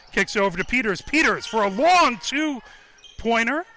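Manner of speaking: excited play-by-play. Real, not acted. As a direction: keep the urgency up through each shot. Commentator calls two shot attempts.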